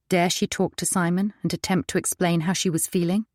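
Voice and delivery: plain reading voice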